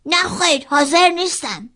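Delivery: put-on high, loud, stubborn child refusing; a sulky little girl digging in her heels; high childish pout, loud and flat-out refusing; grumpy little sister. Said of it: "خواهر کوچولوی بدخلق من چشماشو بست و گفت".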